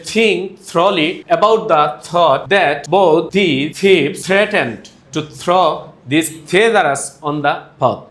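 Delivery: very slow, word-by-word "think thoroughly about the thought"; slow teacher's model of the TH sounds; TH demonstration. Shown as a demonstration. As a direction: separate every word, marking each TH in "threatened to throw". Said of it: "এভাবে বলতে হবে এটাকে".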